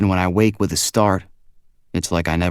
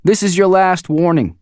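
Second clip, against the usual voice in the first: higher, louder stern warning from the boss; stern leader's voice; 12 semitones higher, 6 dB louder than usual. A narrator's reading. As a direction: warn him firmly, as the man in charge. Max voiced by narrator.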